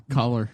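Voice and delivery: "Collar" for color, with an open AH; Indiana pronunciation. Retold or imitated. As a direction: echo the local "Collar", AH in the first syllable. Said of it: "instead of color, they say".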